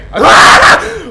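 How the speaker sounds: loud high nervous laugh; shouted laugh. Real, not acted. Streamer recovering from the jumpscare, loud shrieking laugh. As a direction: laugh out the fright.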